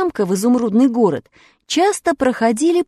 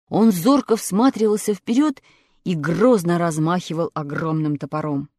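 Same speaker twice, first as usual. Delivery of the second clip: ordinary delivery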